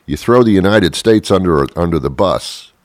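ordinary talk, nothing marked

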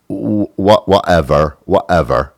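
lazy, indifferent whatever; savored whatever. Shown as a demonstration. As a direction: relish the word whatever.

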